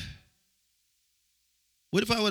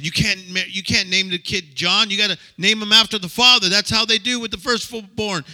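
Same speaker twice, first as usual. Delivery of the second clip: raised, higher insistent protest; indignant relatives' outcry; 6 semitones higher, 6 dB louder than usual. Retold or imitated. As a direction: push back loud like a scandalized crowd. Relatives protest the baby's name.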